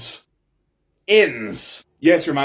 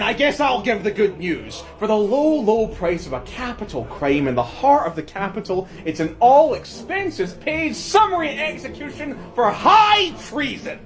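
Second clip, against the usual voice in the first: usual speaking voice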